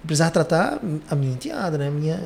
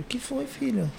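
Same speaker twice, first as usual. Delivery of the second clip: higher, much softer worried question; concerned mother; 8 semitones higher, 9 dB quieter than usual. Retold or imitated. Mother to her crying stepdaughter, soft concerned question. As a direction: gently ask the crying child what happened. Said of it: "Eu falei".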